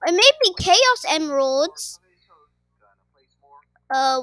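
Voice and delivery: ordinary talk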